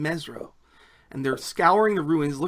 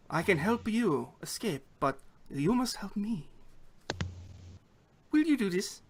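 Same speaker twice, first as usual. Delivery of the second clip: higher-pitched, softly spoken goblin woman; non-goblin voice; 4 semitones higher, 7 dB quieter than usual. Acted. Goblin guard secretly offers a captive escape, non-goblin voice. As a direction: offer help in a higher, softer female voice. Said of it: "says in a non-goblin voice".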